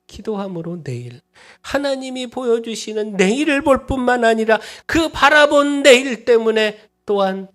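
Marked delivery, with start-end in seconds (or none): 1.6-7.5 high, loud preaching crescendo; impassioned sermon climax, much higher and louder; full-force preaching. Acted. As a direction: preach it out to the whole hall. Preacher surges again.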